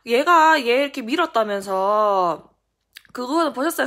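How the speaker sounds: retold child standing up to an adult; indignant protest. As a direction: push back loudly, demanding an answer.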